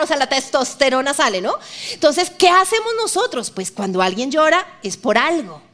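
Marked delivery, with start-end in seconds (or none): none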